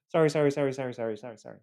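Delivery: rapid-fire string of sorries; retold hurried, placating apology; appeasing apology. Acting out appeasing the partner.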